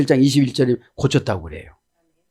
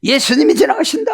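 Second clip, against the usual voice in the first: higher, sharp scolding hush; crowd scolding; 9 semitones higher than usual. Retold or imitated. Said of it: "막 꾸짖어요". (거지를 꾸짖는 군중)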